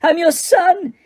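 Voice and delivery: screaming. Acted. Son screaming at his father in anguish. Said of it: "he screamed up at Crouch".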